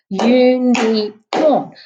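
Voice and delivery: slow, every syllable separated; clapped syllables. Shown as a demonstration. Teacher clapping Unicorn out for the class, slowly syllable by syllable. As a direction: clap out each beat of Unicorn. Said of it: "we can clap that out with our hands".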